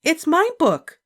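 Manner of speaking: put-on a stubborn little girl digging in; Ramona, little girl. A narrator's reading. Ramona telling her sister, high stubborn child. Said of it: "said Ramona".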